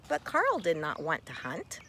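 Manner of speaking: ordinary delivery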